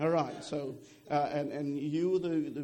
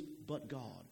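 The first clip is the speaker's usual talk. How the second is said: "But God" dropped low and much softer, reverent; preacher saying "But God" in awe, much softer; hushed but God; 7 semitones lower, 10 dB quieter than usual. Shown as a demonstration. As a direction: let "But God" sink to a hush, lower and quieter. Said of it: "And, and we say".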